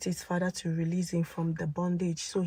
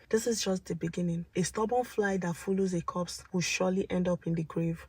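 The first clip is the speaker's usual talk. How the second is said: usual speaking voice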